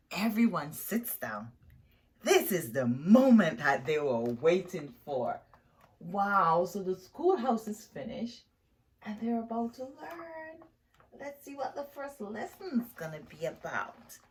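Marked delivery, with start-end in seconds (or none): none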